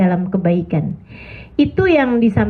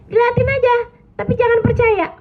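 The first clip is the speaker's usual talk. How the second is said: high insistent advice; 13 semitones higher than usual. Real, not acted. Emphatic advice, high pitch.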